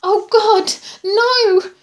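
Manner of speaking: loud, high-pitched cry of horror; terrified gasp. A narrator's reading. Patient seeing the shadowy figure, terrified gasp. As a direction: cry out in sudden terror. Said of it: "She gasped".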